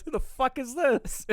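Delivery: high, drawn-out, amused disbelief; mock bewilderment. Retelling the hero's shock at a stranger, mock bewilderment. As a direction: blurt it slowly in a high, baffled mock outburst. Acting out Barry's shocked F-bomb.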